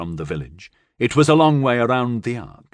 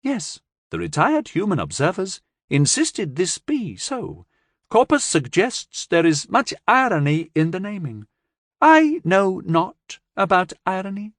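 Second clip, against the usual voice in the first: lightly voiced alien; 8 semitones higher than usual. Alien explains.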